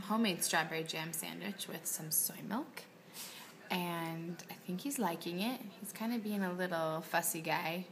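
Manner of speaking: hushed near-whisper at lunch. Real, not acted.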